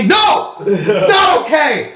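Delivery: high, slow shout of no; hammed-up outburst at the wife; husband snapping at wife, mock frustrated yell. Bond answering his wife.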